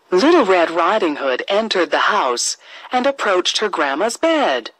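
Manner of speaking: ordinary delivery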